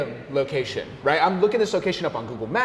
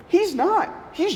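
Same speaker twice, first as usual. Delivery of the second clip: much higher, a burst of surprise; surprised; slower than usual. Real, not acted. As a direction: pop the reveal up high. Realising he isn't the owner.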